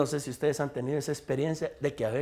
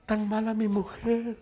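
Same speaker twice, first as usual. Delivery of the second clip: drawn-out, woeful husband's complaint; mock lament; 4 semitones higher, slower than usual. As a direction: stretch it out like a self-pitying moan. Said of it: "lo dicen como que". (lamento fingido, arrastrado)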